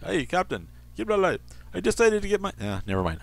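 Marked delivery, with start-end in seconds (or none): 0.0-1.4 high, strained attempt at a Scottish accent; botching a Scottish accent on purpose; failed Scottish accent. Host reading a quote, trying a Scottish accent. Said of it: "I can't do a Scottish accent". 1.7-2.5 squeaky high attempt at a Scottish accent; a high, failed stab at Scottish; bad Scottish accent. Host reading a quote, in a bad Scottish accent. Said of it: "I can't do a Scottish accent".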